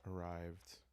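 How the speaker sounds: ordinary talk, nothing marked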